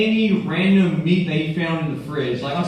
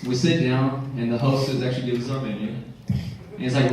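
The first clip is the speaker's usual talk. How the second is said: ordinary delivery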